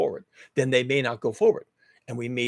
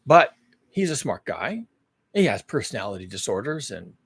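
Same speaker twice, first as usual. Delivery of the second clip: ordinary talk, nothing marked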